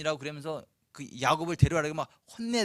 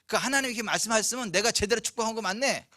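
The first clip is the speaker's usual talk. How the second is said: ordinary delivery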